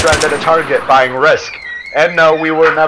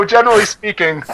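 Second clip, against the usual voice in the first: ordinary talk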